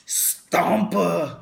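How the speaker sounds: Schwarzenegger accent. Shown as a demonstration. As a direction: say Stompa like Arnold Schwarzenegger, Austrian accent. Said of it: "I always had to say her name with, like, a Schwarzenegger accent".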